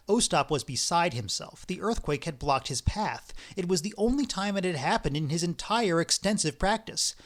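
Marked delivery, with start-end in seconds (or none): none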